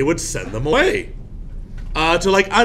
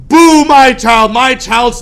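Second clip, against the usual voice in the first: loud, higher, slow booing; public booing; 9 semitones higher, 9 dB louder, slower than usual. Retold or imitated. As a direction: boo your own child in the stockades. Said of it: "you'd be like".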